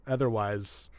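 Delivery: ordinary talk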